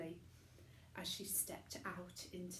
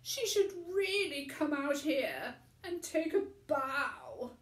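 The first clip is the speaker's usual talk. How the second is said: higher, louder breathy proclamation; announcing tramp; 7 semitones higher, 9 dB louder than usual. A narrator's reading. Tramp announcing Chloe to the studio, higher and louder. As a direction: announce it to the room. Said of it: "announced Mister Stink".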